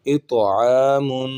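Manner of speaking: slow "اطعامن", bounced heavy t, hummed nasal m; qalqalah and ghunnah. Shown as a demonstration. Teacher demonstrating tajweed, "اطعامن" with echoed ط and nasal m. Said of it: "قلقلہ ہو رہا ہے یہاں پہ طا پر".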